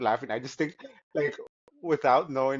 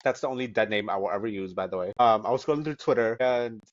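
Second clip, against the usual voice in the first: ordinary talk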